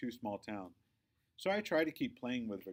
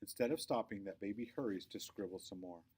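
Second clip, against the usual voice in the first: usual speaking voice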